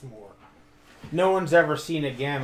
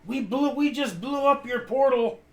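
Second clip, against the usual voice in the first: yelling down; 10 semitones higher than usual. Acted. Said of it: "Tig yells down".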